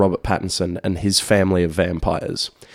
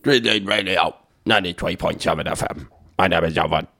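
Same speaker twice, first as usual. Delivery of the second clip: lower, put-on station ident voice; put-on radio host voice; 3 semitones lower than usual. Co-host teasing his partner with a station intro, put-on voice. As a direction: put on a mock presenter voice. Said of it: "I'm just doing that voice".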